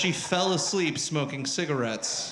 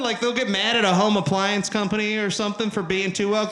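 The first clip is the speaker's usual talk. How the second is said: usual speaking voice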